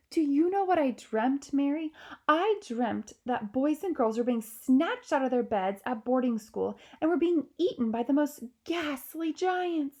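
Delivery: a queen reliving a ghastly dream; horrified queen. Acted.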